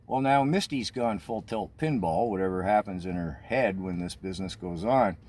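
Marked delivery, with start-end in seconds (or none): none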